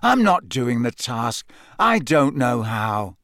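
ordinary delivery

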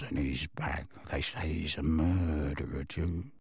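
low, soft, bitter grumble; muttering. A narrator's reading. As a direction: grumble to himself while shuffling out. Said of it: "he muttered all the way out".

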